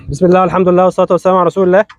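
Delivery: usual speaking voice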